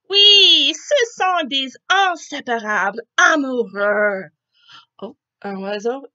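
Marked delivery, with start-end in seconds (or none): 0.1-4.3 eager Rosie. Acted. Rosie explains brightly.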